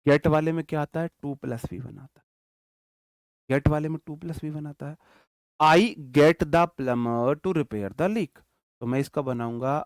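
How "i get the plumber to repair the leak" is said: very slow, loud, word by word; slow dictation. Shown as a demonstration. Teacher dictating an example sentence to his class, very slow and loud. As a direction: dictate each word so students can write it. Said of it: "teacher dictates example sentence".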